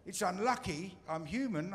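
normal talking voice

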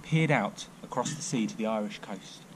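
ordinary delivery, nothing marked

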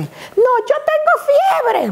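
put-on high, slow little girl claiming fever; whiny sick child. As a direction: fake a fever like a small child. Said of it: "me decía".

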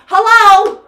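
greeting the class through a cup; bright hello. Shown as a demonstration. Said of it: "I always pick it up off the floor and I say".

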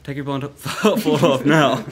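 cracking up mid-sentence; laughing. Real, not acted. Table nearly fell, laughing.

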